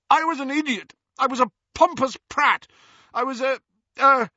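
high, loud outburst, stalling at the end; anguished shout that runs out of words; roaring self-reproach. A narrator's reading. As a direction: berate yourself at full voice. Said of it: "Percy roared".

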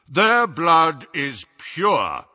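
slow, loud, booming authority; deep, impressive voice. A narrator's reading. Harry as Runcorn vouches for the group, booming. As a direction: make it ring slowly through the hall. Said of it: "his deep voice echoed impressively".

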